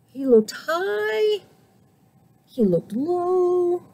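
ordinary delivery

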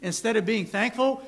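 ordinary talk

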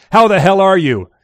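loud, high, exuberant hello; hearty greeting. A narrator's reading. Jabba to Midge on the phone, hearty and loud. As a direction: boom it out to an old favourite.

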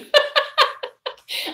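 high burst of laughter; laughing. Real, not acted. Streamer amused by a viewer's comment, high laughter. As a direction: laugh high-pitched in short quick bursts.

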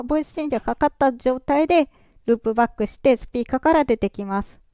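ordinary talk

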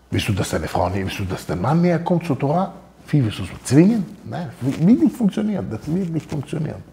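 ordinary talk, nothing marked

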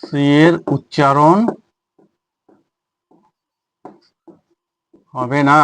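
drawn-out words with a long pause midway; slow, spaced phrases for note-taking; slow dictation. Shown as a demonstration. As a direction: dictate it at writing speed. Dictates the rule while writing.

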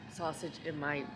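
ordinary delivery

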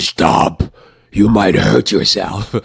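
slow, loud, forceful warning; the scary voice a child takes seriously; stern parental warning. Acted. Parent warning a child as the child hears it, stern and forceful. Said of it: "What they heard is".

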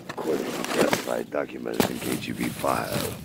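lightly voiced spy-movie narrator; spy thriller narrator. Spy conspiracy bit, low slow.

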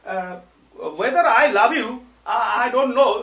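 loud, high demo of Bengali-accented English; Banglish accent. Shown as a demonstration. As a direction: say "love you" as a heavy Banglish "labh iu". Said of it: "আপনাকে example দেওয়ার জন্য".

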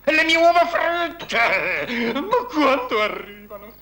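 high, loud, drawn-out shout; impatient yelling. Acted. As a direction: holler for your food to be brought. Yells for his breakfast to come. (urlo acuto e spazientito)

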